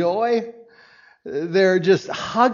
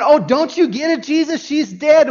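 mocking mourners; 9 semitones higher, 7 dB louder than usual. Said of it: "They're going".

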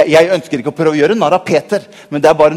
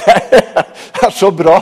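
laughing; 3 semitones higher, 4 dB louder, much slower than usual. Real, not acted. Laughing at the story.

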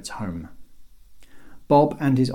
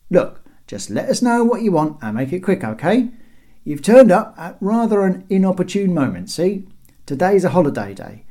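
raised, chatty brush-off; cheeky guard; 7 semitones higher, 6 dB louder than usual. A narrator's reading. Guard wanting breakfast after first watch, impertinent brush-off. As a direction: call down impertinently from the wall. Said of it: "it was verging on impertinence".

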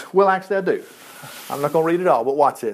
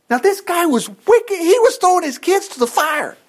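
"this guy was wicked" cried out in outrage; indignant preaching; 11 semitones higher than usual. Real, not acted. Preacher condemning wicked Ahab.

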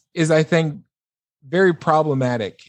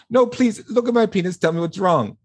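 insistent patient; 6 semitones higher than usual. Retold or imitated.

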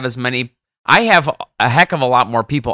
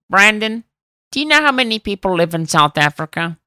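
Elmar; 6 semitones higher than usual. Retold or imitated. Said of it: "That's a good Elmar impression".

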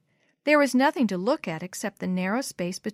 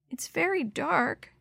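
put-on high, slow, quavering child; little voice afraid of the dark; 8 semitones higher, slower than usual. A narrator's reading. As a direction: draw it out timidly, pitched up. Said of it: "quavered the little voice".

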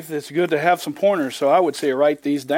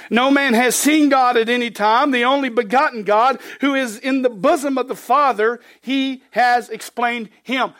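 raised, ringing scripture read; 5 semitones higher, 3 dB louder than usual. Acted. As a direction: proclaim the verse to the congregation. Reads scripture aloud.

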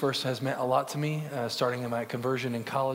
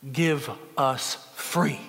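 slow plea; much slower than usual. Retold or imitated. Cinque in chains pleads in court.

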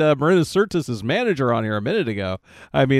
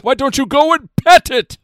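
mock Picard voice; 9 semitones higher, 6 dB louder than usual.

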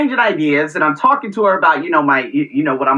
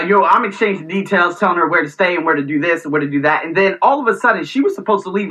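normal talking voice

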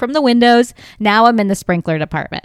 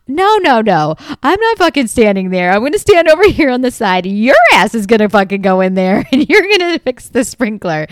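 loud, indignant comic refusal, voice pitched up; 3 semitones higher, 5 dB louder than usual. Retold or imitated. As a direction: act out refusing point-blank, loudly. Acted refusal to the handyman.

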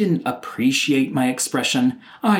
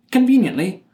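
sarcastic emphasis; 7 semitones higher than usual. Real, not acted. Ironic aside.

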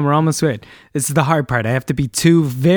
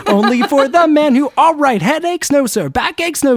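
much higher, brisk yes-sir exchange; rapid-fire quiz; 9 semitones higher than usual. Retold or imitated. Host playing both doctor and patient in a fast quiz, high and brisk. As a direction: fire the questions, snap back yes sir.

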